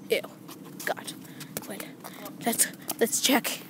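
slow, pretend-sneaky whisper; hushed slow whisper while sneaking a look. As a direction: whisper it as if creeping up on them. Sneaking up to check.

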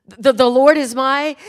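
loud, slow, stumbling recital, imitated; nervous reciting child. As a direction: stammer the first word, then push it out loudly. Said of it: "she got a little nervous".

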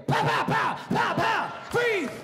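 mock gunshots and yell. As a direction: fire off the shots and bark the order. Imitating police gunfire and order.

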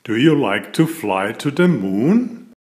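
plain reading voice

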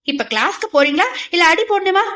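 put-on girl playfully threatening to hit them; comic mock threat. A narrator's reading. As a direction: bluster like a pretend bully. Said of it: "மிரட்டுகிறேன் என்ற பெயரில் சிரிப்பு மூட்டினாள்".

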